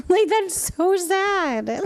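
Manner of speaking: high, slow, laughing through the words. Real, not acted. Streamer laughs telling chat about sad hockey, laughing. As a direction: giggle it out, drawn slow.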